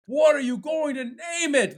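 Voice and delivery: high, impatient demand; insistent. Acted. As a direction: press him for an answer. Friends repeat the question.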